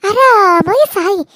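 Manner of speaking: loud, slow, taunting; gloating villain telling her to die; mocking sneer. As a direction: drag out the mocking curse. Villain mocks the victim.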